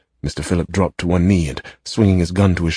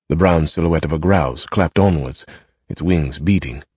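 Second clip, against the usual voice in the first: ordinary delivery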